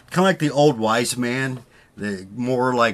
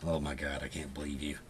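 exasperated wife; 8 semitones lower, 14 dB quieter than usual. Retold or imitated. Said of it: "my wife was just like".

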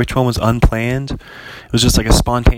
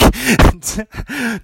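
laugh; 11 semitones higher than usual. Real, not acted. Laughing at own failed accent.

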